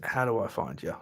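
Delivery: normal talking voice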